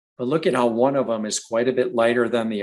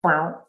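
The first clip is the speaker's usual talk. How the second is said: a short plucked "Bow" imitating a green frog; banjo twang frog call; 5 semitones higher than usual. Shown as a demonstration. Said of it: "sounds like a banjo string twang".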